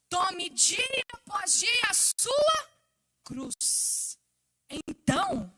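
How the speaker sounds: slow, drawn-out proclamation with big swells; dramatic scripture quote. Acted. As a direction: declaim the verse slowly, dropping away at the end. Quoting scripture with dramatic emphasis. (citação bíblica declamada, lenta e dramática)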